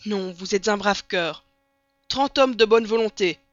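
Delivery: loud, impassioned military call; rousing shout for volunteers; stirred captain's cry. A narrator's reading. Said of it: "s'écria le capitaine, ému malgré lui".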